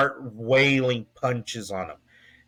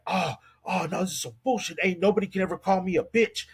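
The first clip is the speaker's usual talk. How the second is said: imitated ranting, pitched higher; pissed; 6 semitones higher, faster than usual. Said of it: "he is pissed".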